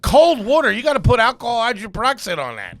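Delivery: retold exasperation. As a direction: scold him, pitch up. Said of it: "I'm like".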